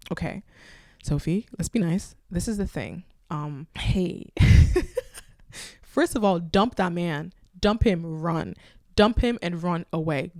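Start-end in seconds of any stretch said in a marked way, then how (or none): 4.3-5.2 sudden high shriek of a laugh; loud shrieking laugh. Real, not acted. Shrieking laugh of disbelief at the boyfriend's story.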